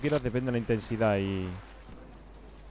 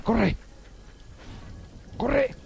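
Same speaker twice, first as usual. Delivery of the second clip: 1 urgent cry; 9 semitones higher, 4 dB quieter than usual. Real, not acted. Fleeing the storm after dying.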